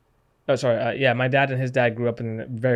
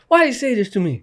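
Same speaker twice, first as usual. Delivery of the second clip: retold wounded complaint, pitched up high; mock-plaintive appeal to mom, much higher than usual; hurt protest; 9 semitones higher, 4 dB louder than usual.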